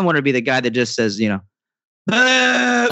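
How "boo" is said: high, drawn-out heckler's boo; yelled boo. Acted. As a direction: play the guy booing from the crowd. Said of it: "just, just yells".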